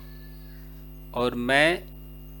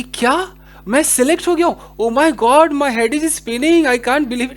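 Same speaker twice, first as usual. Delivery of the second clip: higher and louder, bursting with news; thrilled disbelief; 6 semitones higher, 5 dB louder, slower than usual. Acted. Teacher acting out getting selected, excited and disbelieving. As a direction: act like you just got selected, stunned. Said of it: "या आपको कोई ऐसी खबर मिल गई हो".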